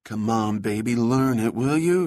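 mildly anxious pleading. A narrator's reading. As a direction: coax it with a touch of worry, lifting at the end. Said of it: "he said anxiously".

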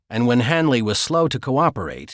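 ordinary delivery

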